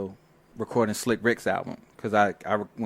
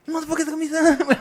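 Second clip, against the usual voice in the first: higher, louder, fast imitated threat; mock angry threat; 6 dB louder, faster than usual. Fifty threatening the studio crew, a mock angry fast threat. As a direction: throw fifty's furious warning back. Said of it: "he was mad as shit".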